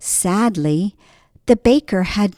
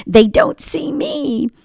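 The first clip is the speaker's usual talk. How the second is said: giggling; 12 semitones higher than usual. Acted. Boy giggles in disguise.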